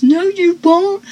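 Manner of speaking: high, mild mock yell; mock scream. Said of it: "as Eddie screams".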